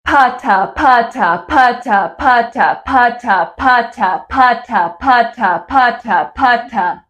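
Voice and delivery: over-articulate each pa-ta in an even beat; clear pa-ta drill. Shown as a demonstration. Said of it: "now we'll put two of those together".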